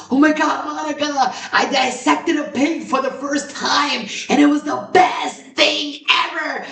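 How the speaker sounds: high, shouted excited friend voice; gushing friend. Retold or imitated. Friend telling about dissecting a pig, high excited shout. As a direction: blurt it out like a thrilled friend. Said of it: "a friend of mine that told me".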